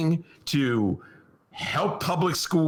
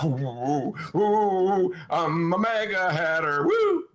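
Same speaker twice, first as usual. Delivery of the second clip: mock maga fan; 5 semitones higher, slower than usual. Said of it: "I'm putting my maga hat on".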